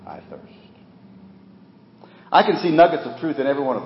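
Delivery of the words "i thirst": near-breathless quiet; hushed. Acted. Jesus dying on the cross, faint and quiet. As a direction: let the words barely leave the lips.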